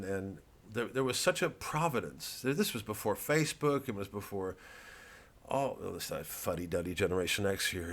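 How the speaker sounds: ordinary talk, nothing marked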